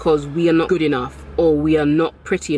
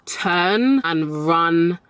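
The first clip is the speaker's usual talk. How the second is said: very slow warning; much slower than usual. Shown as a demonstration. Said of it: "warning girls".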